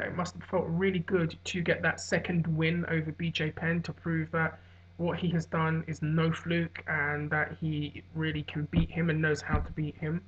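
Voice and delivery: normal talking voice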